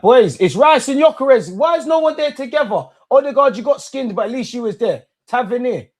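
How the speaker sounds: high, loud fan shouting at the screen; excited yelling. Real, not acted. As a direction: yell at the players, worked up. Fan shouting at players live.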